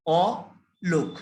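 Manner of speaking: Alok drawn out slowly as অ then লোক; split into syllables. Shown as a demonstration. Teacher sounding out Alok slowly, syllable by syllable. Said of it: "বলছি যে অ, তারপরে হচ্ছে লোক".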